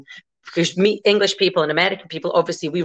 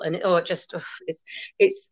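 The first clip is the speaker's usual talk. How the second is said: ordinary talk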